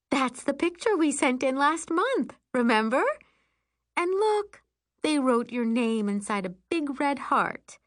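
put-on high, sing-song mom cheering on a child; high motherly enthusiasm; encouraging mother. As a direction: coax a little one with cheer.

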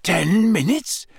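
a touch of shocked croak. A narrator's reading. Harry just woken, learning time is short, croaking. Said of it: "Harry croaked".

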